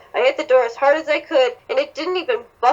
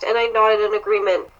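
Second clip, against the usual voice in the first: usual speaking voice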